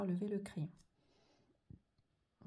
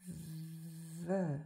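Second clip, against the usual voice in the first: drawn-out syllable; 2 semitones lower than usual. Shown as a demonstration. Teacher writing out the syllable, drawn out. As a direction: hold the opening v long.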